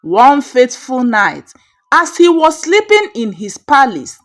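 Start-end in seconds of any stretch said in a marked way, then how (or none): none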